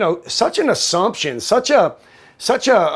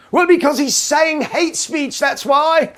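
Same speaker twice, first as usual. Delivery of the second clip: much higher, slow, drawn-out retort; hammed-up exasperation, pitched right up; mock exasperated; 10 semitones higher, slower than usual. Answering a minion on hate speech, mock exasperated.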